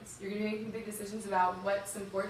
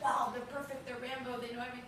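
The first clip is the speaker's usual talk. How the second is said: lightly imitated wide-eyed admiration, higher pitch; mock awe; 4 semitones higher than usual. Young woman recalling her awe of Israeli soldiers, mock awe.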